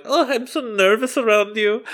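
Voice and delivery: high breathy flirty accent, badly done; hammed-up seductive teacher accent; bad South African accent. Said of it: "our approximations of the accent are not it".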